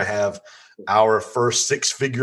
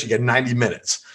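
ordinary talk

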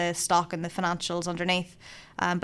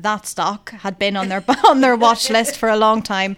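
laughing loudly through the words; 3 semitones higher, 10 dB louder than usual. Real, not acted. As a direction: chuckle it out, amused by the name. Laughing over the stock name.

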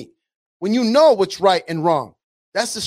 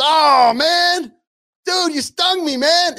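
much higher, pained wail of the stung frog; the frog stung mid-river, crying out high and hurt; 7 semitones higher, much slower than usual. Retold or imitated. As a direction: yelp it out high, betrayed and hurt. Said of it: "the frog says".

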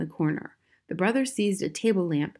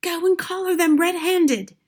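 high, forceful hissed order; mother's pressing, high-pitched hiss; urgent hiss; 10 semitones higher, 5 dB louder than usual. A narrator's reading. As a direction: urge your husband on, high and loud. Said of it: "hissed the mother".

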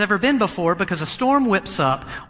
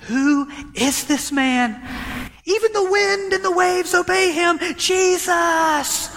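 far higher, amazed cry, building to the name; awestruck; 12 semitones higher, slower than usual. Retold or imitated. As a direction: gasp it out in wonder. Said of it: "what do they say after Jesus does it".

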